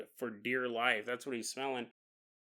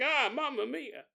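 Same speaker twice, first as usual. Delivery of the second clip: put-on Italian accent; 10 semitones higher than usual. Cooking joke with the meatballs.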